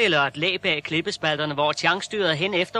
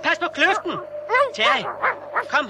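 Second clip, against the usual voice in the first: high, sharp cry of alarm; calling to the dog at the ravine edge; alarmed warning; 11 semitones higher than usual. Acted. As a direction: shout a sudden warning.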